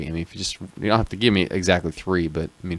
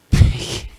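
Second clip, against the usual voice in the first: a squealing snort of a laugh; pig-squeal laugh; 4 dB louder than usual. Real, not acted. Cohost laughing mid-question with a squeal like a pig. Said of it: "that was a pig squeal".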